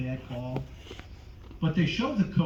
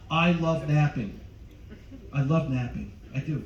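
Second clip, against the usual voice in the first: ordinary delivery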